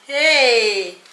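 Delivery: loud, drawn-out hey; a big scolding HEY; shocked outcry. Acted. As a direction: cry out in scandalised disbelief. Acted shocked reaction to confession.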